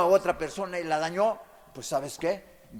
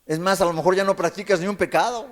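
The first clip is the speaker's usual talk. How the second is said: ordinary talk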